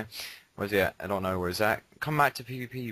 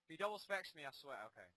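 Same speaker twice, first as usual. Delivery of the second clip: normal talking voice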